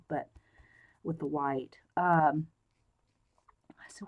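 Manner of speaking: usual speaking voice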